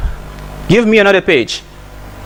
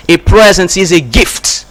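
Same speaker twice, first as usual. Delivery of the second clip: normal talking voice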